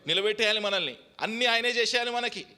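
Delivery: loud, high fiery sermon delivery; preaching at full force. Acted. Pastor exhorting the congregation, loud and high fiery preaching. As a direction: thunder it out from the pulpit.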